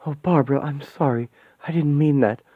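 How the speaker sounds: usual speaking voice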